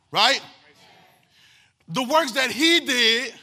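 loud, slow, rising preacher cadence; full-force preaching. Real, not acted. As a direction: build it like a Sunday climax.

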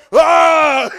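sudden loud high scream; startled "ah!" at shouting volume; waking scream. Acted. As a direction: jolt awake with a full-voiced yell.

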